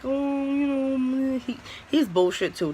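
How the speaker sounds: high, drawn-out, soothing manager voice; imitating kind manager. Cool manager comforting crying employee, high and slow soothing. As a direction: stretch it out like a sympathetic coworker. Manager consoling her, retold.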